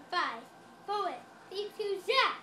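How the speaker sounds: countdown rising to a loud zap; building countdown. Pretend. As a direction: count down, then shout zap. Countdown to magic trick.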